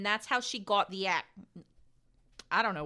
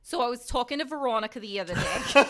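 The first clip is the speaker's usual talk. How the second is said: put-on New York accent; 5 semitones higher, 4 dB louder than usual. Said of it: "I love the New York".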